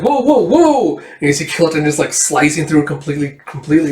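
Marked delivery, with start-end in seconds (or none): 0.0-1.0 high, loud startled whoas; alarmed whoa. Acted. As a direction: rear back in surprise. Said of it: "And Dust's like".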